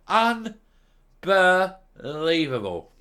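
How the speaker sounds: slow, drawn-out unbelievable; slow exasperated sigh of a word; disgusted disbelief. Real, not acted. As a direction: let the disgust stretch. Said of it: "I'm really angry".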